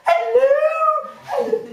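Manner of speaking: put-on very high, loud greeting to a child; exaggerated sing-out hello for little kids; talking to children. Shown as a demonstration. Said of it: "you don't talk to children in the way".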